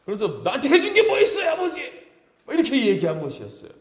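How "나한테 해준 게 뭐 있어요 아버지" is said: high, loud and fast resentful outburst; angry outburst. Retold or imitated. Eldest son blowing up at his father, high angry outburst. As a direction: snap back at the father in a sudden flare of anger. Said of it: "막 화를 버럭 내는 거죠".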